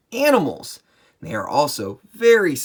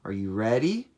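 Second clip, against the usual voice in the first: ordinary delivery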